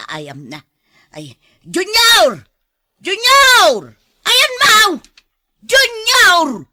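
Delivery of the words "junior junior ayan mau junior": loud, high, drawn-out calls for Junior; calling out. Acted. Aunt searching the yard for Junior, calling loudly. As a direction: shout across the yard, searching.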